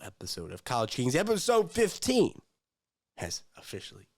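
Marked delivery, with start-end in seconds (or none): 1.1-2.4 host sign-off. Real, not acted. Podcast sign-off announcement.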